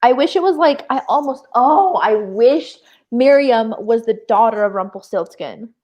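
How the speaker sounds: normal talking voice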